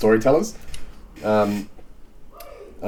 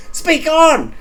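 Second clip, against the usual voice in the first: very high, louder command; high voice try; 5 dB louder than usual. Acted. Reader cycling voices, very high and loud. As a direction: pitch it way up. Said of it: "cycle through every voice".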